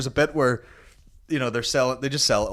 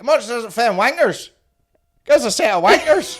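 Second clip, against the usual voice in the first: high, loud mock customer voice; hammed-up high impression of a market customer; imitated local boy; 12 semitones higher, 8 dB louder than usual. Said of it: "this boy's like".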